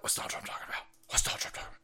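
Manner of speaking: breathy whispered repeat; teasing whisper. Pretend. Streamer teasing viewers, hushed whisper. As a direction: whisper it like a secret.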